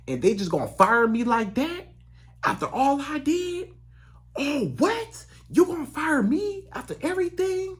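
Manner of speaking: high, stunned outrage at being fired; shocked disbelief. Retold or imitated. Fired worker to his boss, shocked and high. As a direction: pitch leaps up in stunned disbelief.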